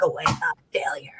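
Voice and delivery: hushed, ominous whisper; dramatic whisper. Acted. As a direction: whisper it like a grim verdict. Host's dramatic verdict announcement.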